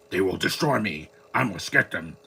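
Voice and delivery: lightly mock the wooden line delivery; mocking the bad villain dub. Mocking the Madam Web villain's dubbed lines, stiff mock delivery. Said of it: "nobody talks like that".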